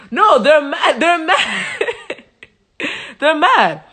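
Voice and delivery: laughing. Real, not acted.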